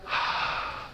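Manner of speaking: long audible sigh out; deep sigh out. Shown as a demonstration. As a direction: let the breath go with a sigh. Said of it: "Let it out".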